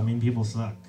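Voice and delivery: ordinary delivery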